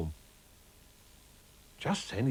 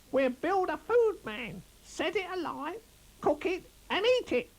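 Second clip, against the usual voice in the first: squeaky rabbit voice; 3 dB louder than usual. Acted. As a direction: squeak it out like a small excited rabbit. Narrator voices Chris Rabbit.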